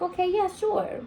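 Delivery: high, thin mock-cheerful "sure"; forced cheerful agreement; sarcastic okay. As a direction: brush it off with fake brightness. Fake agreeing after bad news.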